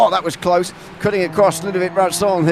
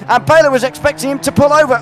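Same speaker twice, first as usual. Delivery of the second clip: excited; 8 semitones higher, 5 dB louder than usual. Real, not acted. Excited call of overtake attempt.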